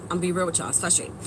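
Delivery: soft, low, worn-out; quiet frustration. Real, not acted. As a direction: let it fade out, fed up. Admits frustration, fading.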